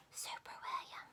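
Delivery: whispered, barely audible; very quiet whisper. A narrator's reading. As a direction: breathe it out shyly, hiding his face. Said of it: "he said very quiet".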